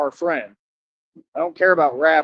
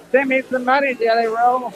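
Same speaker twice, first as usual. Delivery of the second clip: high, pleading mock voice, much higher than his own; mock begging; 7 semitones higher than usual. Mocking a man begging a rapper for money, high pleading whine. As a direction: whine it like a needy beggar.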